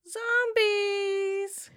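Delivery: high wailing cry on the word; spooky zombie wail. Pretend. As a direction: let it rise into a ghostly moan.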